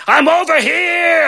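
slow, high call pretending to be Beast; faked call for help. Fairy godmother faking Beast's call to guide Belle, slow and high. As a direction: fake a distant cry to lure her in. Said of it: "So I have to fake it".

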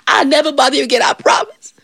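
high, pleading promise; desperate promise. Acted. Devil stuck in the bush promises John, pleading.

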